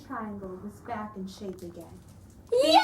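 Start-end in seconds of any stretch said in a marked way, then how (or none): 2.5-2.8 much higher, louder happy ending; cheery sign-off. A narrator's reading. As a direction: sing out the end of the story. Story ending announced.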